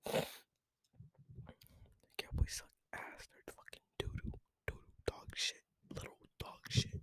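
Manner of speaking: soft, voiceless whisper; hushed, secretive jab; whispered trash talk. Real, not acted. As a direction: whisper the insults under your breath. Insults whispered as an aside.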